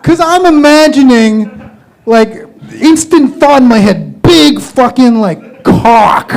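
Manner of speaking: ordinary delivery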